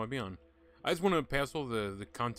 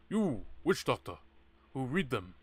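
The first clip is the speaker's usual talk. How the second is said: [name] impression; 5 dB louder, slower than usual. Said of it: "I'm doing like [name]".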